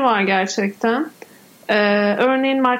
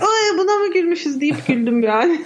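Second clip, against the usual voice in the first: after laughing hard; 6 semitones higher than usual. Real, not acted. Laughing about an old comedy.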